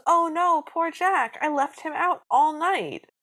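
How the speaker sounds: high, loud mock dismay; dismayed Cinderella. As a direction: wail it as a hammed-up Cinderella. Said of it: "Cinderella goes".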